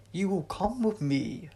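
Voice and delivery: slow, loud alien command; menacing creature voice; harsh Sontaran order; deep harsh alien. A narrator's reading. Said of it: "his voice deep and harsh".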